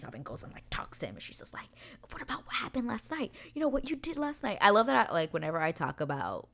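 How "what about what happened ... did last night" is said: high-pitched confronting. Acted. Kate confronting Anthony about last night, high and insistent. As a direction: press him in a raised pitch.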